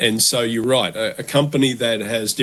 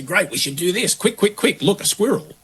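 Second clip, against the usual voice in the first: much higher, giddy and rushed; overexcited idea rush; 9 semitones higher than usual. Retold or imitated. Pitching raging new ideas to a colleague, hyper-excited. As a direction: bounce from idea to idea like a distracted dog. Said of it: "all of these raging new ideas".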